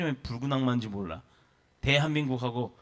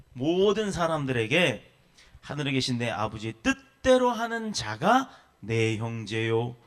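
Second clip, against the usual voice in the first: ordinary talk, nothing marked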